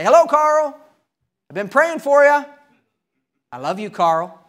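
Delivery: bright, high, loud call of hello; cheery preacher greeting. Retold or imitated. Preacher greeting his neighbor from the driveway, cheerful and loud. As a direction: sing out a friendly hello across the driveway.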